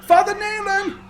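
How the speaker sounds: high loud call down the hall. Acted. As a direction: shout the name urgently. Calling out again, much higher.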